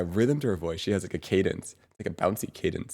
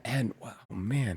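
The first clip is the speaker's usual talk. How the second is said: ordinary talk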